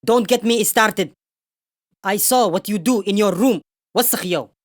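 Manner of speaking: ordinary talk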